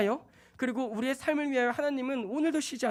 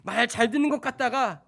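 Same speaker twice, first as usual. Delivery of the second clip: usual speaking voice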